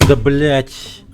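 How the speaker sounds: loud excited yell; triumphant shout. Real, not acted. Triumphant reaction to kill.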